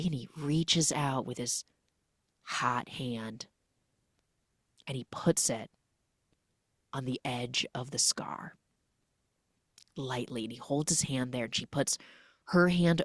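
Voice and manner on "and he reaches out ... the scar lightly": dropping to a soft near-whisper; hushed. Pretend. Narrating the tender scene to co-host, hushed. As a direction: hush it like a secret. Hushed retelling of intimate moment.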